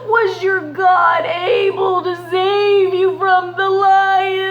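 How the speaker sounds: high, loud tearful cry, slow; king shouting into the den, near tears. Acted. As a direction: call into the pit with desperate hope. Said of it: "And he shouted".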